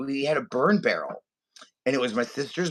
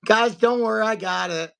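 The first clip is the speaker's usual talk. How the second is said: imitated overconfident dad; 7 semitones higher than usual. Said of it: "He's like".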